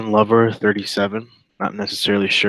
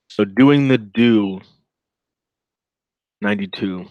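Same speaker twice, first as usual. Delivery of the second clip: slow emphatic slogan; slower than usual. Shown as a demonstration. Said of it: "We'll play on words there".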